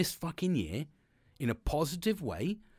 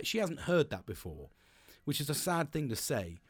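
ordinary delivery

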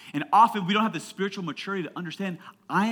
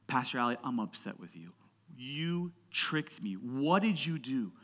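ordinary talk, nothing marked

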